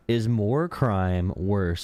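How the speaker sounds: each word drawn out slowly; slow mock question. Streamer mocking the debater, slow and drawn out. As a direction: spell it out slowly like a smug debater. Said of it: "the Patrick Wallet meme".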